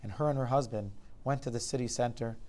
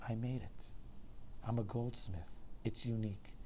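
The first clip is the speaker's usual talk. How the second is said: softer, weary answer; lightly voiced sighing goldsmith; subdued old man; 6 dB quieter than usual. A narrator's reading. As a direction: answer quietly, with resignation. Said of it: "The man sighed".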